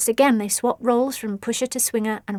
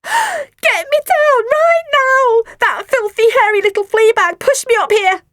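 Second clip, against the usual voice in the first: very high, loud panicked squeal; girl on the roof squealing; 13 semitones higher, 9 dB louder than usual. A narrator's reading. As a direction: squeal from the rooftop. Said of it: "she squealed".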